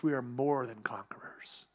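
soft, breathy confiding whisper. Acted. Preacher closing quietly to his listeners, whispered. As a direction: lean in and whisper it. Intimate close of sermon.

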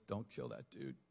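very low, hushed command; inner voice. Retold or imitated. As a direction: drop deep and soft, a voice from within. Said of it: "this voice inside of me".

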